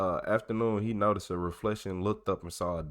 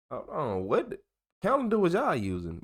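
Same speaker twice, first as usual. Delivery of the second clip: incredulous mock; 8 semitones higher than usual. Real, not acted. Mocking the report's odd date.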